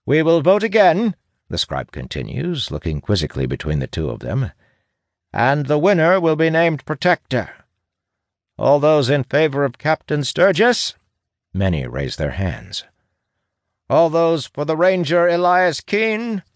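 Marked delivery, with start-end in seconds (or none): 0.0-1.2 high, loud official call; proclaiming scribe. A narrator's reading. Scribe addresses the gathering. 5.3-10.9 high-pitched official announcement; proclaiming scribe. A narrator's reading. Scribe calls the vote. 13.9-16.4 high-pitched official announcement; proclaiming scribe. A narrator's reading. Scribe calls the vote.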